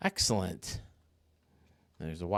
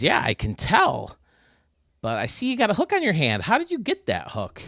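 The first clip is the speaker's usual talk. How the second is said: higher, curious interviewer voice; lightly voiced casting guy asking; auditioner; 8 semitones higher, 6 dB louder than usual. Joke's interviewer quizzing the pirate, lightly higher and curious. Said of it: "And the guy says".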